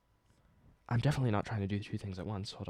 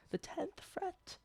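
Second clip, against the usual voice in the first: squeaky; 11 dB quieter, much slower than usual. Real, not acted. Squeaky high mock repeat.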